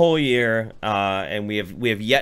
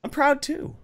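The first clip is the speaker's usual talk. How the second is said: mock proud; 11 semitones higher, 4 dB quieter than usual.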